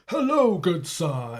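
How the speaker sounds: mock-polite greeting, starting very high; high, swooping mock-formal hello. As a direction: greet them with hammed-up gentlemanly politeness. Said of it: "is in a mocking way".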